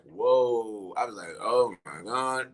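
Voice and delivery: low, slow, hushed amazement; quietly awestruck, under the voice; stunned reaction. Retold or imitated. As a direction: retell being stunned, dropping low and drawing it out. Retelling own stunned first reaction.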